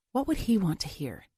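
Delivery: plain reading voice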